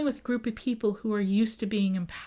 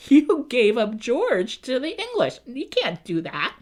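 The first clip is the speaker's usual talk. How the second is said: louder, slower accusation; outraged followers; 3 semitones higher, 7 dB louder, slower than usual. Retold or imitated. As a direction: scold the chief for betraying George. Followers scold the sachem.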